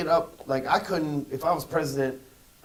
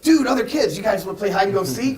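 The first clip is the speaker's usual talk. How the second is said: put-on much higher, louder, eager "Dude, other kids"; thrilled to find new playmates; a kid shouting to other kids at the playground, overjoyed; excited kid greeting; 5 semitones higher, 6 dB louder than usual. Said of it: "You're like".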